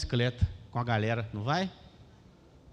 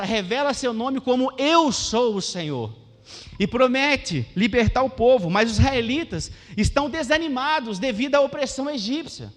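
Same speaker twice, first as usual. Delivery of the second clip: booming pulpit reading; forceful scripture reading; 7 semitones higher, 6 dB louder than usual. Acted. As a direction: proclaim it to the whole congregation. Reads Exodus passage with force.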